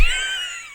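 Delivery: very high squeal of laughter; high pitched laughing shriek. Real, not acted. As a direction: squeal and crack up. Laughs at foot joke.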